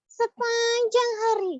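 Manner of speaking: squeaky puppet, singsong verse; high puppet voice reciting a rhyme. Acted.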